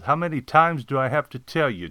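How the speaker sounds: a restrained yell of reproach; scolding yell. Acted. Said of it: "I yelled".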